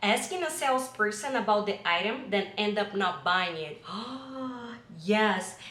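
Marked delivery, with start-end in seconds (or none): 3.9-5.0 quiet shocked gasp; soft gasp. Real, not acted. Hearing the salesperson topic, a soft shocked gasp. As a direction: gasp at the thought.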